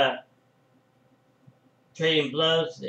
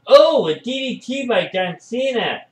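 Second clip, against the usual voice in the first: higher, louder excited call; 8 semitones higher, 6 dB louder than usual. Real, not acted. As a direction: call the move, thrilled. Big move lands.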